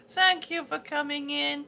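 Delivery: normal talking voice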